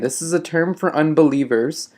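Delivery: ordinary delivery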